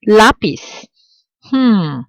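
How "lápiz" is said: Lápiz, high firm LÁ, dropping away; first-syllable stress. Shown as a demonstration. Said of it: "stress falling on the last syllable, but it doesn't".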